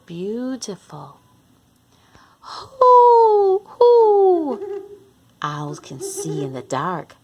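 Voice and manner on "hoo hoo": loud high breathy hoot hoot; owl hoot. Acted. Reading to kids, hooting like the owl.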